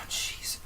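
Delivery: tired breathy sigh; sighing jeez. Real, not acted. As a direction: sigh it out from the heat. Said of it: "it's really hot in here".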